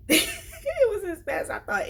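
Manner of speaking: high laughing relief, words through a giggle. Real, not acted. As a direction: laugh it off as you say it. Laughing relief after retelling.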